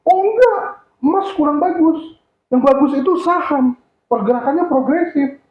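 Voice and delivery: very high, emphatic objection; acted know-better rebuttal, pitched well up; contradicting client. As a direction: correct the salesman as a client who must win.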